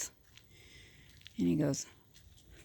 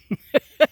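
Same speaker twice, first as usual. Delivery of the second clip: high, loud burst of laughter; 10 semitones higher, 13 dB louder than usual. Real, not acted. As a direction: crack up at your own punchline. Laughs at own punchline.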